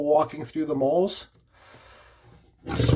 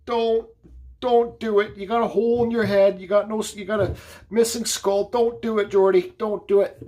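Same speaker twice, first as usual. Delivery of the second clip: urging himself; 5 semitones higher than usual. Retold or imitated. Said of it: "all I could think to myself".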